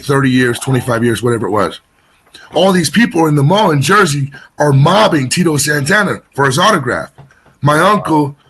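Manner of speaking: normal talking voice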